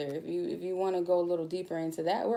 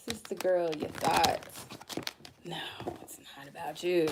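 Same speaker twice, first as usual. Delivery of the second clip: ordinary talk, nothing marked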